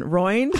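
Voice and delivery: Roined as one syllable, "ROYND"; questioning "Roined", rhyming with joined; quizzical mispronunciation. Retold or imitated.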